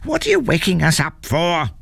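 high, grumbling troll mother. A narrator's reading. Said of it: "grumbled his mother".

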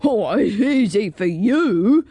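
put-on protesting dragon; plaintive dragon complaint. A narrator's reading. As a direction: object as a reluctant storybook dragon. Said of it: "said the dragon".

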